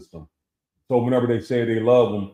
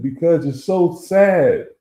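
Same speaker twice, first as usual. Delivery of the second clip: higher, louder imitation of a tearful mother; retold crying mother; 7 semitones higher, 6 dB louder, slower than usual. Mother at grandson's send-off, imitated tearful answer. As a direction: draw it out slowly, imitating an upset mom. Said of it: "she was crying".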